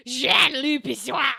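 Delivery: slow, loud mock gruff French growl; gruff French accent. Shown as a demonstration. Streamer showing chat her gruff fake French, slow and loud. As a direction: growl the name like a gruff Italian man. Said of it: "I'm just like".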